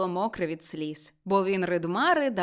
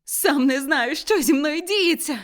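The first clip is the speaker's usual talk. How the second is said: raised, high, overwhelmed voice; loud joyful bewilderment; agitated cry; 6 semitones higher, 6 dB louder than usual. A narrator's reading. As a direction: cry out, overcome with happy confusion. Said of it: "закричав він, плачучи і сміючись".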